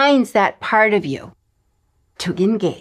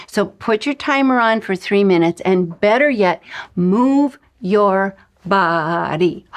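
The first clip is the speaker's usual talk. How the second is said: ordinary delivery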